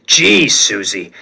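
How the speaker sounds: low, slow gravel-free drawl of a big guy; teasing Thing. Acted. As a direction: tease her gently. Ben chuckling, teasing.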